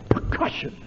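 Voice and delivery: whispered percussive consonants; unvoiced consonant clicks. Shown as a demonstration. A teacher whispering the word for a class to show consonant percussion. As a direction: breathe the word out with no voice, stressing its consonants. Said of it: "the percussion is in here".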